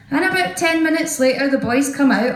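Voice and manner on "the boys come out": ordinary talk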